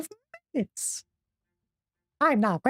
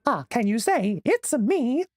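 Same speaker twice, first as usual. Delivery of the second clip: Mickey Mouse voice. Pretend. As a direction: keep the Mickey voice going. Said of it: "haven't done a Mickey voice".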